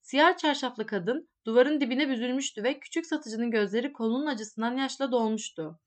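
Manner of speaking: ordinary delivery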